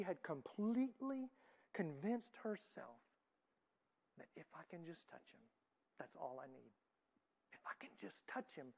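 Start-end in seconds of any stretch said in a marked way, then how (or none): 4.2-6.7 hushed inner thought. Retold or imitated. 7.5-8.7 breathy whisper of a desperate hope; whispered thought. Retold or imitated. Woman's inner thought whispered.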